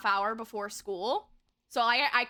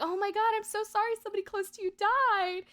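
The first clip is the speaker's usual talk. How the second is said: high-pitched, overdone concern; imitation of a sympathetic adult; mock sympathetic counselor; 9 semitones higher, faster than usual. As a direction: console in a raised, gushing pitch. Counselor consoling her, retold.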